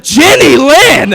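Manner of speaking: hammed-up offended lecturing; mock pompous. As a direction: drag out her name primly. Pompous reply to sister.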